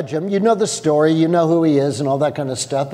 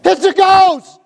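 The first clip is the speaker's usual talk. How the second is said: high, loud panicked shout; terrified cry; 13 semitones higher, 7 dB louder, slower than usual. Pretend. Pastor acting the disciples crying out in fear, panicked shout. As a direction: scream it out in sudden fear. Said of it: "They cried out in fear".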